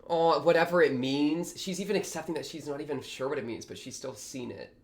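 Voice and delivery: ordinary delivery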